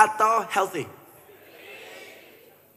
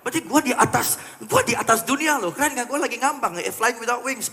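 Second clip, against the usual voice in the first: high, boastful showing-off voice; 8 semitones higher, 3 dB louder, faster than usual. Retold or imitated. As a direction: brag like someone floating above the world. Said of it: "Ngomongnya begitu kan?". (gaya sombong bernada tinggi)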